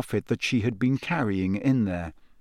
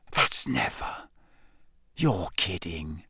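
soft and slow, lower, awed hush; whispered; 5 semitones lower, 6 dB quieter, slower than usual. A narrator's reading. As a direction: breathe it in disbelief. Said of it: "Ron whispered".

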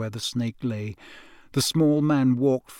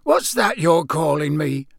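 put-on higher, louder, defiant old man; 4 semitones higher, 6 dB louder than usual. A narrator's reading. Old man challenging the stranger who named him, defiant. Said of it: "said Frank defiantly".

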